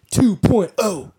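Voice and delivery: drawn-out hype; dramatic announcement. Pretend. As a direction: announce it like a big title reveal. Hyped announcement of the new edition.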